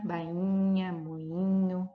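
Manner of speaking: ordinary talk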